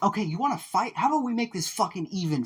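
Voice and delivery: high-pitched fighting taunt; cocky challenge. Acted. Curse squaring up to the Nomu, high challenging voice.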